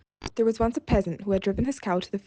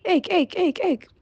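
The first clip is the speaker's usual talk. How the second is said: high, sharp frog croaks; croaking frogs; 9 semitones higher, 5 dB louder, slower than usual. A narrator's reading. Frogs crying at the peasant from the pond, high croaks. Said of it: "cried continually".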